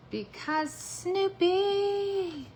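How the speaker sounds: high, slow, delighted cry; excited squeal. Real, not acted. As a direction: stretch it out in delight. Excited exclamation.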